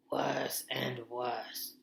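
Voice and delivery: gloomy Mister Beaver. Acted. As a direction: weigh each word with dread.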